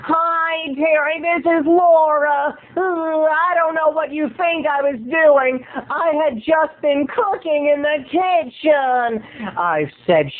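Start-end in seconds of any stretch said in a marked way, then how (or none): 0.1-9.2 high, slow, breathy voicemail; imitating Laura. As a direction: draw it out high and flustered. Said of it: "leaves a message on my machine".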